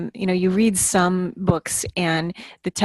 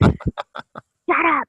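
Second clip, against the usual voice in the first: laughing mock protest; 8 semitones higher, 3 dB louder than usual. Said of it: "comments she makes".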